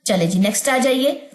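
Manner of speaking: normal talking voice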